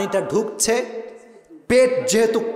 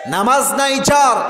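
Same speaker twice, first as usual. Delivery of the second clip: high, ringing preacher's call; impassioned, raised sermon delivery; forceful proclamation; 4 semitones higher, 4 dB louder than usual. Real, not acted. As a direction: proclaim it to the congregation. Proclaims the quoted saying to crowd.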